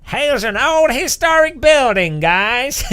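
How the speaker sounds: high, slow American tour guide; American accent. Retold or imitated. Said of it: "when you go to America and they're like".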